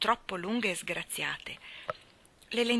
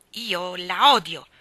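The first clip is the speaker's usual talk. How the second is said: much louder, slow hateful cry; choked outburst; 10 dB louder, much slower than usual. Acted. Anna bursts out at Rachel Lynde in slow, loud rage. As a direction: blurt it out choking with rage. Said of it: "sbottò con voce soffocata".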